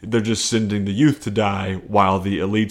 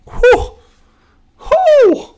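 embarrassed whew; 8 dB louder than usual. Real, not acted. Recovering after the failed accent.

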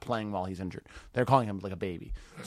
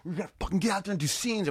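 higher-pitched, rousing pep talk; super energetic; 7 semitones higher than usual. Retold or imitated. Improv teacher rousing teenage workshop students, imitated energetic urging. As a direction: imitate an inspiring coach, lifted pitch. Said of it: "super energetic for the first one".

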